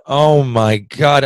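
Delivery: slow, drawn-out disbelief; slow, groggy amazement; stunned oh my God. Retold or imitated. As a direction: stretch it out, dazed from the hit. Stunned after taking a hit.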